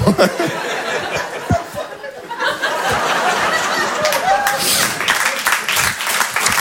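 very high, fading forced giggle; long fake courtesy laugh; breathy high nervous laugh; polite laugh. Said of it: "le rire de politesse".

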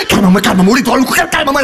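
ordinary talk, nothing marked

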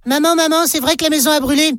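put-on high, quick, questioning child; child's voice. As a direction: ask mum like an anxious little kid. Child questions mother in joke.

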